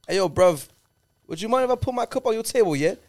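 mock London lad asking nicely; cheeky British bloke voice; British accent. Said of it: "we've been doing accents all weekend".

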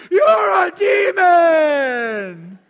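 very loud, high, drawn-out shriek; quoting the family member, shrieking; mock screaming. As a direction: shriek it slowly like a crazed relative. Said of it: "And then she said".

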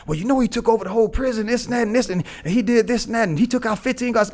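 high-pitched imitation of breathless gossip; excited rumour-spreading. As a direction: mimic someone hyping up a tall tale. Mimicking the growing prison rumour.

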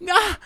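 sudden high, loud "Ah!"; startled outburst on spotting someone; mock yell. Acting out running into a celebrity, mock yell. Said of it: "you're like".

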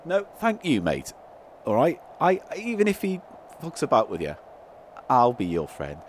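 low, reassuring pal voice; Paul. Acted. Paul promising Toodle Pip friendship, low reassuring voice. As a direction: sink the pitch and promise friendship.

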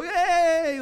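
high, loud cheering yay; crowd cheer. Retold or imitated. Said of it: "we'd all go".

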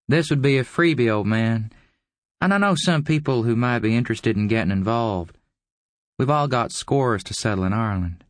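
high, knowing schemer; scheming visitor. A narrator's reading.

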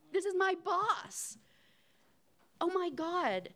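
pitched high and slow in disbelief; incredulous. Real, not acted. Telling the audience, still stunned by her boss's gall.

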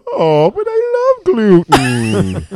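exaggerated Simpsons-style wail. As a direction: drag it out like a crying cartoon character. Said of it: "I do Simpsons voices sometimes for fun".

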